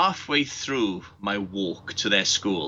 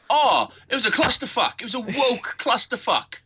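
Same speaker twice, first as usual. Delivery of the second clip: high-pitched Cockney outburst; 12 semitones higher than usual. Real, not acted. Co-host rants about Capcom's presentation, turning Cockney. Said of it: "you're turning Cockney now yourself".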